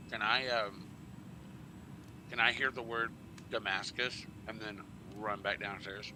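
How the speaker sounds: ordinary talk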